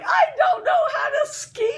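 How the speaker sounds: high, loud laughing protest; laughing shout. Retold or imitated. Lisa protesting to her friends that she can't ski, a high laughing shout. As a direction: yelp it out, half laughing.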